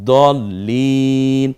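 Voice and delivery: very slow "দলিন", leaning on the doubled "ল"; doubled consonant. Shown as a demonstration. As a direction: dwell on "ল" in "দলিন", pronouncing it twice. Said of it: "দুইবার উচ্চারণ করতে হয়". ("দলিন"-এর "ল" দুইবার উচ্চারণ করে, টেনে)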